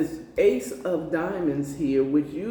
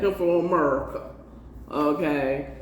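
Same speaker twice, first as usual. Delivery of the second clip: laughing; 2 semitones lower than usual. Real, not acted. Laughing repeat of own joke.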